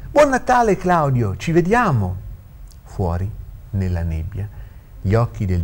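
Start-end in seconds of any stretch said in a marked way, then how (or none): 0.1-2.2 very high, loud called-out goodbye; hearty parting call; jovial farewell. Acted. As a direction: shout Christmas wishes as you walk away. Old lawyer calls goodbye.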